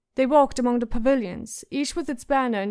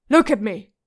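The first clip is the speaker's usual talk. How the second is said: louder barked order; shouted command; 6 dB louder than usual. Acted. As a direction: bark it as he loses patience. Hound demands she look.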